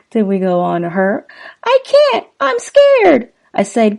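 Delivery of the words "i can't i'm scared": high frightened falsetto woman; scared Kim voice. Acted. Kim terrified in the car.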